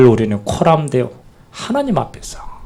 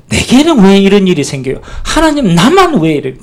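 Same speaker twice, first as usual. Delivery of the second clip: higher, louder whining outcry to God; aggrieved complaint; 6 semitones higher, 4 dB louder than usual. Acted. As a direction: cry out to God, wounded and indignant. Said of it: "막 속에 분통이 날 거".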